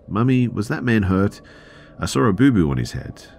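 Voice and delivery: put-on a curious little girl asking her mum. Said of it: "My little girl asked".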